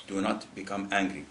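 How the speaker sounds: low, soft, measured advice; calm counsel. Retold or imitated. Prophet answering a man seeking advice, calm and quiet. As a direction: advise quietly and gently.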